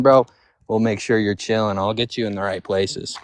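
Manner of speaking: normal talking voice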